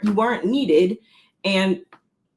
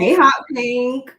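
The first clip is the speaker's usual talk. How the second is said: slow, sing-song hey to a viewer; drawn-out greeting; 4 semitones higher, 4 dB louder, much slower than usual. Real, not acted. Cohost greeting a viewer, slow and sing-song. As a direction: stretch out the hey, loud and high.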